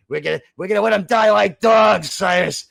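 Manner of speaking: loud, hammed-up war cry; battle cry shout. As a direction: shout it like a warrior.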